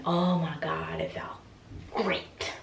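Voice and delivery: slow, hushed savouring; drawn out, melting into a whisper; blissful relief. Real, not acted. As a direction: sink into it, dropping to a whisper.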